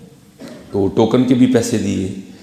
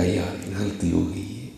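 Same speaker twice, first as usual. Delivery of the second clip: much softer, tearful apology; a hushed, retold weeping sorry; tearful plea; 10 dB quieter than usual. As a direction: beg forgiveness, near tears. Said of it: "رو کر کہنے لگا".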